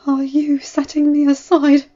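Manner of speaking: trembling voice. A narrator's reading. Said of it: "asked in a trembling voice".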